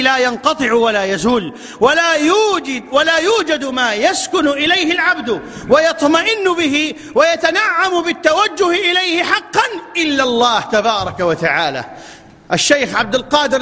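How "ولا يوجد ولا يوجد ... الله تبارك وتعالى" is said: slow, raised preaching voice; solemn preacher building to a climax. Acted. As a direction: lift the line and drive toward حقا. Preaching at full force.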